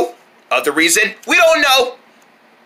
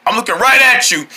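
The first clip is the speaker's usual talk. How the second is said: usual speaking voice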